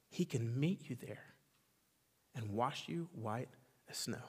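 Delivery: soft pastoral comfort; tender reassurance. Acted. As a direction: drop to a hush and reassure. Softened promise after the climax.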